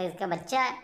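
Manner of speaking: normal talking voice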